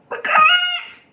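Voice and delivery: very high, loud squawk; shrieked punchline. Acted. Answering the chicken riddle, sudden high shriek. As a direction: screech it out suddenly. Absurd punchline after long pause.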